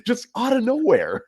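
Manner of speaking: high, loud, laughing amazement; can't believe where they came from; giggly astonished outburst; laughing disbelief. Real, not acted. Amazed at team's sudden form.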